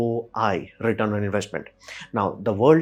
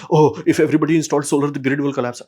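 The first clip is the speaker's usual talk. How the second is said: mock panic; 3 semitones higher, 5 dB louder than usual. Mocking fearful public on solar.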